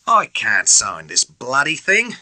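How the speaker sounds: petty, irritable refusal; peevish. A narrator's reading. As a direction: grumble it like a sulky officer. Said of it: "he said peevishly".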